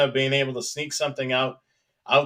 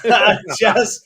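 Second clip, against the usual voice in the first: laughing; 9 semitones higher, 7 dB louder than usual. Real, not acted. Laughing at Chris's chat post.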